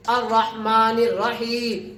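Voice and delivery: slow, syllable by syllable; measured, drawn-out teaching recitation; spelling-out recitation. Shown as a demonstration. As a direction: draw out each syllable for learners. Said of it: "آج میں ہجا کر رہا ہوں".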